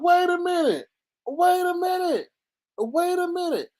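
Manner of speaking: high-pitched mock double-take; taken aback. Vlogger retelling her reaction to his slip, high-pitched disbelief.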